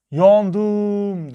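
high, loud ringing echo; mountain echo. A narrator's reading. Mountain answers the girl's cry, drawn-out echo. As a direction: call it back long and high like an echo. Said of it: "Karşıki dağdan bir ses geldi".

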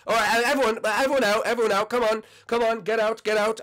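exasperated host calling out orders; ordering everyone out. Acted. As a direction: clear the room in a hurry. Host throws all contestants out.

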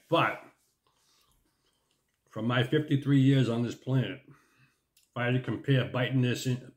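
munching while speaking; talking with chip at lips. Real, not acted. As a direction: mouth partly full. Seen 0: chip pressed to lips while talking; chewing itself not clearly visible.

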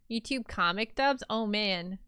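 ordinary talk, nothing marked

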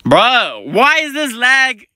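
high, drawn-out exasperated whine; exasperated complaint. Real, not acted. Gamer fed up with lag mid-fight, high exasperated wail. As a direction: complain high and slow, pleading with the game.

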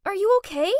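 high, loud anxious call; worried. Acted. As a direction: rush to her side. Helping fallen mom.

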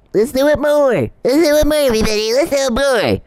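high, squeaky mock voice; mocking Charles. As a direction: lampoon him as a giddy fool. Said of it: "And Charles wakes up".

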